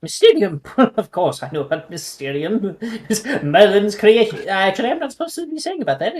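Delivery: lightly voiced prim old owl; a hint of a haughty old owl; fussy old owl. Owl talks of Mysterium.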